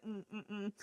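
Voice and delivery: disapproving mom. Retold or imitated. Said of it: "she's like".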